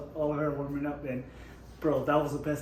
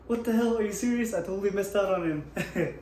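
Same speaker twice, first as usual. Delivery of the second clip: shocked disbelief; 5 semitones higher than usual. Retold or imitated. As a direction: act stunned you missed him. Retold shock at missing fighter.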